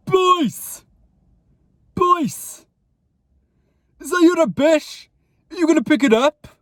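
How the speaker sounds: high, loud confrontational holler; very loud, high-pitched telling-off; intimidating shout. Retold or imitated. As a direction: call the culprits out, trying to intimidate. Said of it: "my most deepest voice possible".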